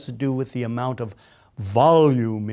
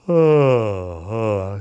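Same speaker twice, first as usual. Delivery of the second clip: breathy sigh then a louder ah; big sighing ah; 3 semitones lower, 5 dB louder than usual. Shown as a demonstration. Coach models the big throat-opening sigh. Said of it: "Make that big".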